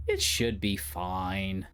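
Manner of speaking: slowed half-doubtful self-reassurance; slow drawn-out reassurance. Shown as a demonstration. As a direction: stretch "It should be fine" out slowly. Says the line twice, slowed.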